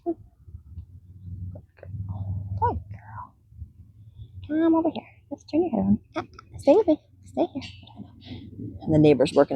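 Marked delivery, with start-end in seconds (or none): 0.0-3.3 hushed praise. Real, not acted.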